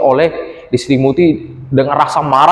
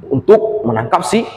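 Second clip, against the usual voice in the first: plain reading voice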